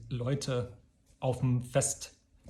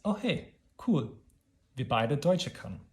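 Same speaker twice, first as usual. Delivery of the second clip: pleased discovery. Pretend. Imagined chat with an Italian.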